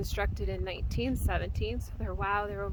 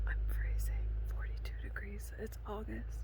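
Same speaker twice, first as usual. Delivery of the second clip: whispering; 9 dB quieter than usual. Real, not acted. Cold night, whispered.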